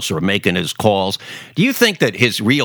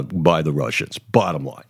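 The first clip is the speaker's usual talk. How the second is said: ordinary talk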